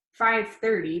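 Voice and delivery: plain reading voice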